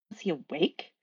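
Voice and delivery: usual speaking voice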